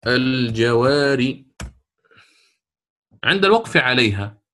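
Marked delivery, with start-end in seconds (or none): 0.1-1.4 الجوار stretched slowly at the pause; drawn-out pausal form. Shown as a demonstration. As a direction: say الجوار long and slow, stopping on the r. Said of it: "ماذا ستقول في".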